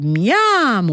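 miam gourmand et chantant, haut perché. A narrator's reading. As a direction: savoure d'avance, voix qui monte. Reading the letter, relishing food. (miam gourmand)

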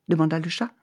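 ordinary delivery

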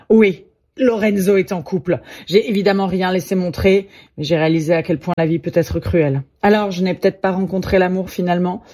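ordinary delivery

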